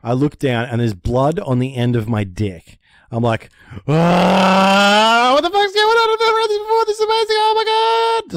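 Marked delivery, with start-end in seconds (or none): 3.9-8.3 squealing way up high in a panicked rush; freaking out. Retold or imitated. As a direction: shriek it like you just found blood. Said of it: "So I was freaking out".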